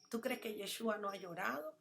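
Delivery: ordinary talk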